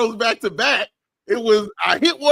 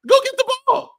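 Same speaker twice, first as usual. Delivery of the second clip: heated yell; 12 semitones higher than usual. Real, not acted. Host venting at a player, yelling high and exasperated. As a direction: drive the point home hard. Heated rant about rebounds.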